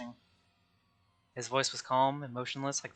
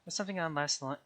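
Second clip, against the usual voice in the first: normal talking voice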